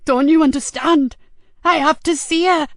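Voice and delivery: high, loud, tearful pleading; tearful outburst. A narrator's reading. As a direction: plead desperately as the tears break through. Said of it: "Tears broke through".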